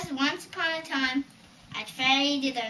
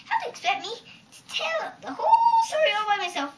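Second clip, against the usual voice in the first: higher, louder, fast wailing complaint; much higher fast exasperated wail; exasperated cry; 9 semitones higher, 6 dB louder, faster than usual. Acted. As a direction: wail it out, fed up. Hen cries out in complaint.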